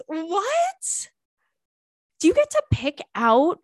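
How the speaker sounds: high, loud incredulous what; stunned question to the letter writer; shocked squeal. Real, not acted. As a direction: blurt it out in disbelief.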